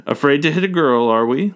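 loud, teasing challenge. A narrator's reading. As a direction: tease the boy playfully. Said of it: "Lord Dace asks teasingly".

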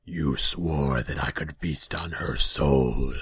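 slow, loud, drawn-out demon growl; ominous slow creature voice. A narrator's reading. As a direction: draw the words out like a menacing demon. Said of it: "heard a whisper in his ear".

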